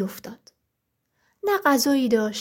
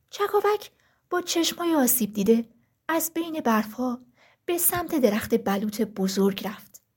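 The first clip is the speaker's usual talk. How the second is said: ordinary delivery, nothing marked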